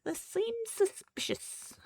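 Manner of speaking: high, slow wary cat voice; suspicious cat. Retold or imitated. Voicing Rice Cake wary of eardrops, high and slow. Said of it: "he's like".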